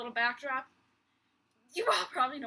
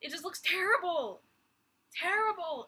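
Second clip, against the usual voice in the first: higher, louder dismay on "terrible"; real disgust; 6 semitones higher, 5 dB louder than usual. Real, not acted. Vlogger ranting about an ugly theme park makeover, appalled. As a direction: really lean on "terrible", appalled.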